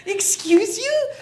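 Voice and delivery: high, drawn-out affront; slow, high-pitched outrage; indignant retort. Retold or imitated. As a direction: stretch it out in mock offense. Indignant retort to old lady.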